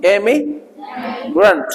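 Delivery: Immigrant stretched out slowly, with a rolled र; slow model pronunciation. Shown as a demonstration. Coach stresses the real र sound, slow and clear. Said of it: "जब आप real sound बोलोगे".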